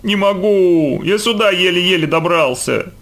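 high, drawn-out howl; stretched, high-pitched whine of pain; loud wailing. A narrator's reading. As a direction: wail it like a boy faking agony. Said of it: "завыл громко".

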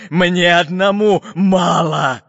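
slow, loud, greedy growl; Bul-Bul grumbling. A narrator's reading. Pirate captain refuses to share, grumbling slowly. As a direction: stretch each word possessively. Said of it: "пробурчал капитан Буль-Буль".

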